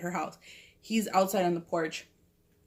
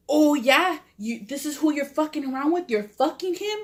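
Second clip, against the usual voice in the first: retold indignant challenge, pitched up; mock-accusing, higher than usual; jealous confrontation; 6 semitones higher, 3 dB louder than usual. Jealous Vato confronts her about another man, mildly angry imitation.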